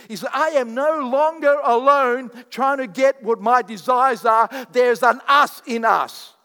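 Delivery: loud, slow, ringing sermon delivery; full-force preaching. Acted. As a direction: preach it to the back row.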